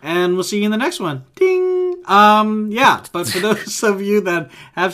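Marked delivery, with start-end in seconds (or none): 1.3-1.9 high-pitched sung-out "ding"; imitated bell ding. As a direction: chime like a little bell. Imitates a bell to end intro.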